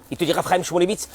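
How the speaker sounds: ordinary delivery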